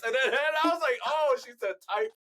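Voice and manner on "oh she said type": higher-pitched mock dawning realization; sudden realization. Retelling his mishearing to friends, sudden realization. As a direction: act out the penny dropping.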